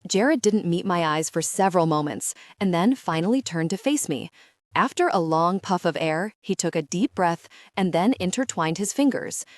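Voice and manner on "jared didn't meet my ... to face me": ordinary delivery, nothing marked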